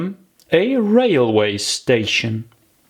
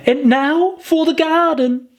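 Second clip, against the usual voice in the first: put-on high childlike voice, hopeful; a little girl brightening up; high eager little girl; Alice, eager. A narrator's reading.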